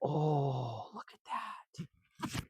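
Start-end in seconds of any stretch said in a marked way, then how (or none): none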